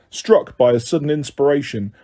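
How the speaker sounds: plain reading voice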